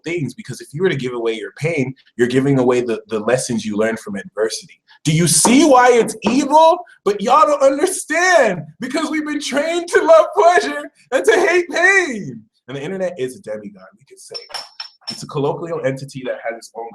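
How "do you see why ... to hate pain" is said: high, loud, fervent appeal; rising passionate rant, much higher and louder; impassioned preaching. Real, not acted. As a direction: plead with the chat, voice climbing. Preaching about the universe.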